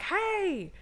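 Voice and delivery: a drawn-out, held-back "yelling" call; imitated yell from below. Passerby yelling up after the TV crashes, mock yell. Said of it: "people yelling down below".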